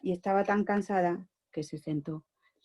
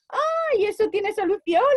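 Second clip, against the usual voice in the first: much higher, bright exclamation; excited swans; 12 semitones higher, 5 dB louder than usual. Acted. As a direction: burst out with a happy idea.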